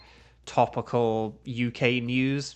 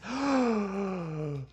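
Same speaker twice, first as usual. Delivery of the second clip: gasp; 6 semitones higher, 4 dB quieter than usual. Real, not acted. Noticing something.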